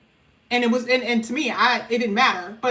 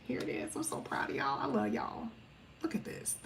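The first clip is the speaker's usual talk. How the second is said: soft whispered aside; 3 semitones lower, 17 dB quieter than usual. Real, not acted. Reader whispering love and pride to viewers, soft whisper. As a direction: whisper it like a proud secret.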